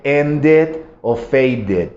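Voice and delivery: ended and faded slowly, each -ed said as its own syllable; ended, faded with a clear extra vowel; slow, syllabic -ed; full -ed syllable. Shown as a demonstration. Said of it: "si se fijan".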